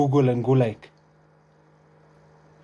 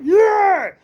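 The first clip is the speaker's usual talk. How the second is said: high, loud Nigerian ad shout; Nigerian accent; 6 dB louder than usual. Retold or imitated. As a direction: belt it like an old Nigerian ad. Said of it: "would have a Nigerian voice in the end".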